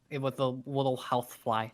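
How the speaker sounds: ordinary talk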